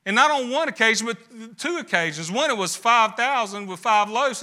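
normal talking voice

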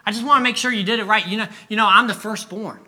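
put-on high-pitched, pleading boy; worried kid pressing his case; anxious little boy. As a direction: plead nervously with Dad. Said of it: "He's like".

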